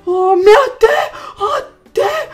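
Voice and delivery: loud, high panicked cries. Real, not acted. Gamer panicking near a big snake, loud high cries. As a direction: gasp and cry out in panic.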